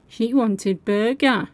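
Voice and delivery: ordinary delivery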